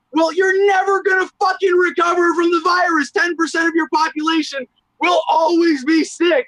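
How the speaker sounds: high, forceful exclamation; heated outburst. Real, not acted. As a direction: rant it loudly in exasperation. Heated rant about test numbers.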